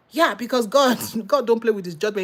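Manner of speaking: normal talking voice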